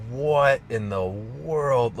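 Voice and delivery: drawn-out, slow wonder at the sky; awed disbelief. Retold or imitated. To her own past self, staring up at the sky, slow and awed. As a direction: let the words stretch in amazement. Retelling awe under the open sky.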